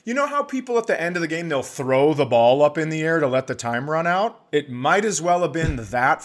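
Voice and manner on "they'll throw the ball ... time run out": ordinary delivery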